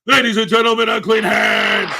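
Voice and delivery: hammed-up host introduction; announcer voice. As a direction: boom it out like a boxing announcer.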